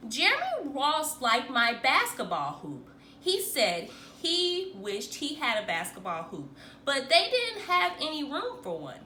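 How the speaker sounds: ordinary delivery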